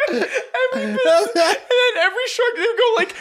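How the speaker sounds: words squeezed out through helpless high laughter; laughing so hard it nearly turns to tears. Real, not acted. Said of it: "I'm crying".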